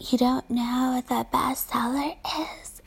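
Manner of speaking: slow, hushed mock whisper. Mocking the coworker's question in a slow whisper. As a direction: breathe it like a scandalised secret.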